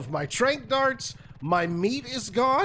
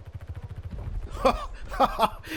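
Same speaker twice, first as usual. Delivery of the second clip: laugh; 4 semitones higher, 4 dB quieter than usual. Real, not acted. Laughing at easy tame.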